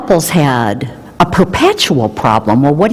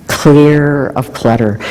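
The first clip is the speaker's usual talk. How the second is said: normal talking voice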